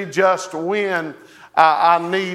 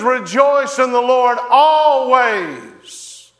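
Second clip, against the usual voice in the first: preaching proclamation; 6 semitones higher, 3 dB louder, slower than usual. Real, not acted.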